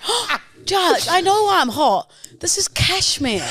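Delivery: put-on British aristocratic accent. Retelling the cashmere moment, mock British aristocratic accent. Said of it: "very distinct British aristocratic accent".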